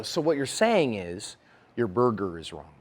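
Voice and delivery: slow, low and softened condescension; patronizing. Acted. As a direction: spell it out as if to a simpleton. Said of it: "That's very patronizing".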